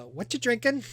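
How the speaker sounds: put-on high-pitched kid taking an order; young halfling kid. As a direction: ask it brightly high, like a young server. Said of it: "a young kid".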